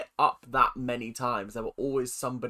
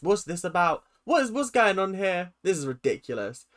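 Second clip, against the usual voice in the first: ordinary delivery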